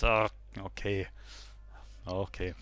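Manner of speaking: low, grudging grumble; grumbling. Acted. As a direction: give in with a reluctant grumble.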